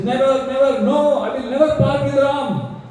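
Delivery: shouting refusal. Acted. Dasharath refusing to give up Ram, mock shout. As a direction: refuse with rising desperation. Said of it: "And starts shouting".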